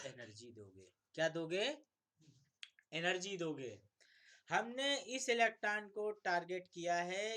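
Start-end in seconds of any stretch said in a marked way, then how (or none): 4.5-7.4 higher and louder, eager; excited announcement. Real, not acted. Teacher to students, excitedly describing how the electron is targeted. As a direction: announce it like a breakthrough.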